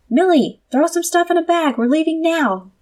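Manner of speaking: high, loud call to the backyard; yelling. Acted. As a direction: shout it so Millie hears out back. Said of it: "I yelled so she could hear me".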